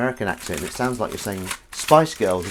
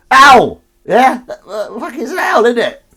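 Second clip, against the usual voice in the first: much higher, loud laughing squeal; excited owl squeal; 13 semitones higher, 12 dB louder than usual. Real, not acted. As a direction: shriek with delight at the owl. Surprised delight at an owl ding.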